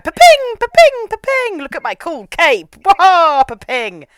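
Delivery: very high, loud bouncing sing-out; playful showing off. Retold or imitated. Pilot leaping ship to ship in her cape, playful showing off. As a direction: squeal each bounce like a cartoon leap. Playfully voicing leaps across ships.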